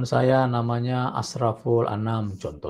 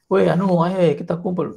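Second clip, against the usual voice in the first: higher, louder casual call in a Bugis regional accent; 6 semitones higher, 7 dB louder than usual. Shown as a demonstration. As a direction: hail your friends in a Bugis regional accent. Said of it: "pakai logat daerah".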